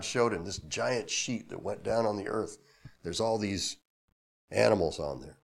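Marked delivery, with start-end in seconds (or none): none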